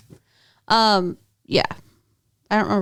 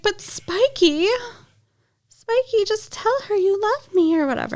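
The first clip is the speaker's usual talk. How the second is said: whiny Harmony; 11 semitones higher than usual. Retold or imitated. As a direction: beg like a clingy girlfriend, pitched way up. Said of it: "Harmony's like".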